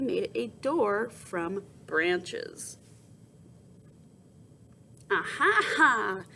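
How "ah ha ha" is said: gator laughing. Acted. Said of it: "Third Gator laughed".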